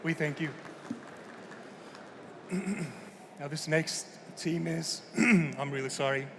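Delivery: hoarse, croaky sick voice; talking through a sore throat. Real, not acted. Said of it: "Sorry for the croaky, uh, voice too".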